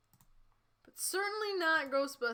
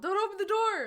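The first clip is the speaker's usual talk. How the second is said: loud, high-pitched warning shout; yell; 10 semitones higher, 8 dB louder than usual. Acted. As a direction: yell it out in alarm. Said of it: "but Shaggy yelled".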